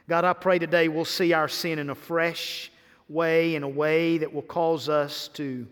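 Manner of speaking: prayer. Real, not acted. Preacher praying to God before the message, low reverent prayer.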